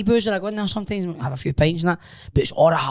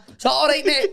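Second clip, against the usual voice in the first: much higher, light chummy put-on; mock chummy voice; 12 semitones higher than usual. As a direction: ask it in a hammed-up matey way. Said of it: "I'm like".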